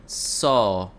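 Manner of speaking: ordinary delivery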